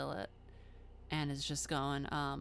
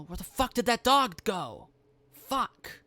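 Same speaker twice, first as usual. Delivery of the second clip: loud, high-pitched panicked outburst; raised, agitated officer's cursing; alarmed cop yelling; 5 semitones higher, 8 dB louder than usual. Acted. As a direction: shout it at your partners, rattled. Armed cop loses sight of wolf.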